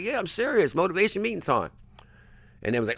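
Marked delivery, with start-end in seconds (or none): 0.0-1.7 much higher, upbeat boss announcement; cheerful insistence. Retold or imitated. Boss retells calling staff to a morning meeting, much higher and upbeat. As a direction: sing out the meeting call, way up high. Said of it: "I'm like".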